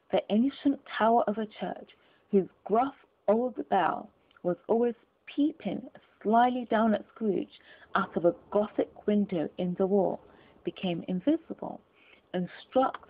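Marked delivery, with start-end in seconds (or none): none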